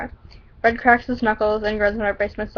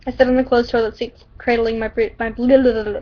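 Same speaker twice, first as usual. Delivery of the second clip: ordinary delivery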